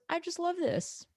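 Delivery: a playful hint of a starry-eyed classics fan; mock dreamy fan. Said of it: "and you're like".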